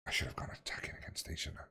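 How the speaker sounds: breathy self-doubting whisper under the breath; quiet whispered second-guessing; whispered aside. Real, not acted. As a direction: whisper it softly to yourself. Muttering to himself mid-game.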